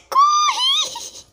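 high shrill yell of panic; high shout. Real, not acted. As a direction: scream at yourself to run. Panicked escape in game.

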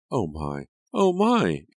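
high, loud shocked cry; a distressed repeated "Oh my!"; alarmed cry. A narrator's reading. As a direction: gasp it out in alarm. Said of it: "Angelica cried".

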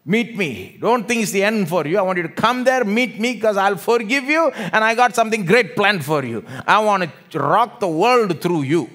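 high, very loud impassioned sermon delivery; full-force preaching. Acted. Jesus calling disciples to Galilee, loud impassioned preaching. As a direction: build a rousing promise at the top of the voice.

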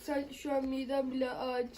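soft, fast pleading whimper; weak pleading whimper. Pretend. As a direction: plead weakly, near tears. Patient begs doctor.

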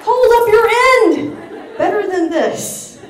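high, loud, slowed hold up your end; exasperated scolding. Real, not acted. To American tourists in Paris, a high loud scolding. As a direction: scold the tourists with mock outrage.

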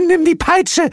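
very high, loud panicked shriek; frantic cartoon screech; screaming. A narrator's reading. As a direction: scream the order from behind cover. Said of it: "schrie sie".

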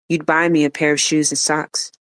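plain reading voice

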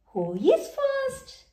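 very high cheerful question; a friendly dentist asking; Doctor Elephant greeting children. Acted.

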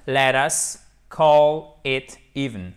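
slow, careful model sentence; slow model reading. Shown as a demonstration. Teacher reading aloud slowly to French learners. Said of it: "teacher models full form".

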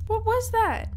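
high alarmed question. Real, not acted. Player spooked by a sound, high alarmed question. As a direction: snap up in pitch, spooked. Startled by noise.

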